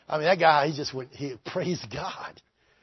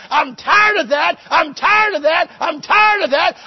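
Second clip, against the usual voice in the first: mock inner cry; 11 semitones higher, 10 dB louder, slower than usual. Voiced inner stirring, repeated cry.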